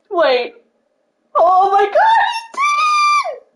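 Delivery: high, loud fangirl shriek of disbelief; ecstatic shriek. Real, not acted. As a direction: scream it like your idol just noticed you. Fan sees idol liked tweet.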